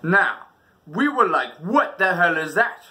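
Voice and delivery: usual speaking voice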